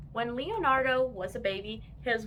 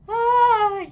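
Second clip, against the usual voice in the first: loud high startled yell; 12 semitones higher, 13 dB louder than usual. A narrator's reading. Boy yells at mom in the mall, loud startled ah. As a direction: cry out in shock at the underpants. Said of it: "yelled Taylor J".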